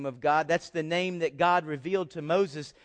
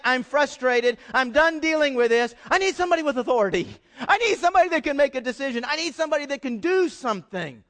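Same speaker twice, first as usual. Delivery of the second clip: fast, loud, higher exasperated rant; frustrated; 6 semitones higher, 6 dB louder, faster than usual. Retold or imitated. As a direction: rattle it off like a fed-up customer at the counter. Voicing the frustrated customer.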